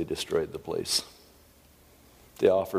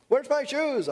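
put-on high kid voice calling out; whiny child; slower than usual. Child calling to parent about lost shoes. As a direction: play a helpless kid calling for help.

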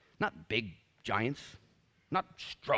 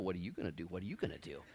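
low, soft, understated turn-it-back question; the Lord replying; 5 semitones lower, 8 dB quieter, faster than usual. Acted. The Lord turns the question back on the believer, low and quiet. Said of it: "the Lord looks at me and says".

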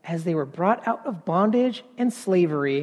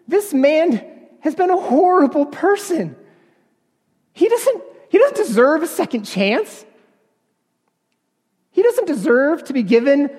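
higher and louder, rising indignation; angry inner protest; 8 semitones higher, 5 dB louder than usual. Retold or imitated. Preacher recalling his fury at his father-in-law, higher and louder angry protest. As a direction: let the outrage spill out, pitched up and loud. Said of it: "I felt".

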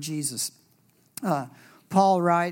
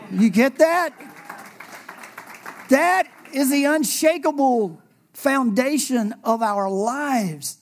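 preaching; 7 semitones higher, 4 dB louder, slower than usual. Acted. Preaching climax.

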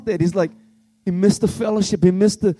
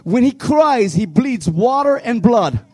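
impassioned preacher, raised voice; preaching at full force; 3 semitones higher than usual. Real, not acted. Youth pastor preaching to his church, impassioned.

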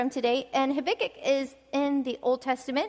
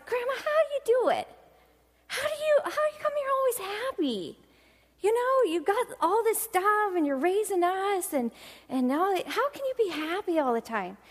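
put-on child's question; 6 semitones higher than usual. Little girl asking grandma.

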